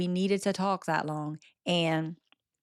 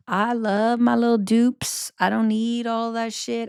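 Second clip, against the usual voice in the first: proud declaration; 5 semitones higher, 5 dB louder than usual. Real, not acted. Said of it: "declares her own contentment".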